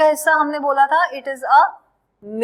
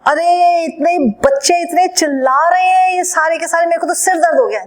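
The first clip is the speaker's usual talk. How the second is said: annoyed complaint; 5 semitones higher, 6 dB louder than usual. Pretend. Teacher acts a complaining person.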